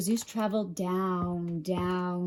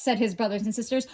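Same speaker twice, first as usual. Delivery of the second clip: ordinary delivery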